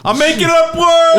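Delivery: high, loud mock yell; mock shout. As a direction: belt it out in a goofy high voice. Shouting in a put-on voice.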